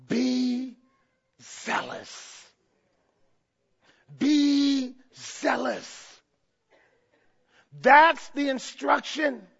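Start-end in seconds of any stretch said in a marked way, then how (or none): 0.1-2.5 breathy whisper of a sacred command. Acted. Preacher hushing the church for Christ's instruction, whispered. 4.2-6.2 slow. Acted. Repeating the instruction slowly.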